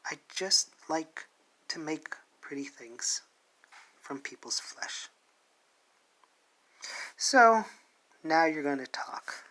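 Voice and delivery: lightly acted creepy villain whisper. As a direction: hush it like a torturer savoring the threat. Torturer villain's quoted menace.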